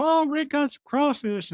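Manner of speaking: high, bright mock cheer of the lab staff; mock excited coworkers. Lab staff hearing Rick brought crawfish, mock excited cheer. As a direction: squeal it like happy coworkers spotting food. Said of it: "they go".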